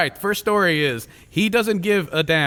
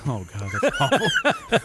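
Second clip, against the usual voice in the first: laughing; 10 semitones higher, slower than usual. Real, not acted. Reacting to co-host's joke.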